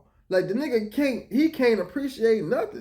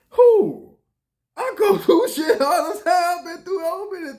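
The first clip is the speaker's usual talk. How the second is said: high, loud, half-laughing protest; indignant shout; 10 semitones higher, 6 dB louder than usual. Real, not acted. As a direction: shout back that you suffer too. Defending himself, heated.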